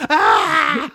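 sudden high yelp; mock yelp of pain. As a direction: yelp like your hand got stepped on. Dude's hand stepped on.

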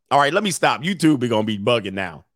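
ordinary talk

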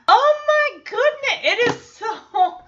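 high, loud squeal through a laugh; delighted, high-pitched gushing; excited outburst. Real, not acted. Reacting with delight to the scene.

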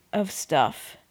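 usual speaking voice

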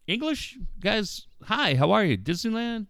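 high, hopeful tourist questions; high eager questions. Retold or imitated. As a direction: coax a blank family with hopeful high questions. High-pitched pleading to foreigners.

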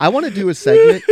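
high, loud, bursting announcement; excited announcement. Real, not acted. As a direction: announce it with a big jump up. Announcing a new segment.

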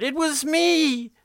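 high, drawn-out desperate shout; shouted confession. Acted. Brooks owns up to what he did, shouting. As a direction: shout it out, stretching the words. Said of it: "he shouted".

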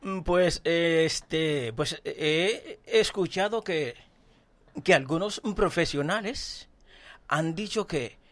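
Castilian Spanish accent. Real, not acted. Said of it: "Dale, dale con el español".

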